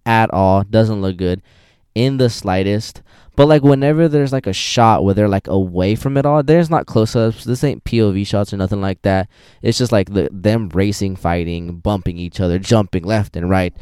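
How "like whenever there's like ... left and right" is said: ordinary talk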